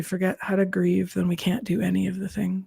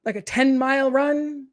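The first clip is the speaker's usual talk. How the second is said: proud inner voice; 4 semitones higher, 5 dB louder than usual. Retold or imitated. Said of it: "or do you say".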